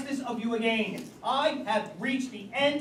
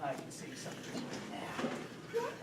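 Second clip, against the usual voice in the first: whispered, slow and hushed; hushed whisper at the keyhole; 8 semitones lower, 12 dB quieter, slower than usual. Acted. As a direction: breathe it so the girl won't hear. Peering through bathroom keyhole.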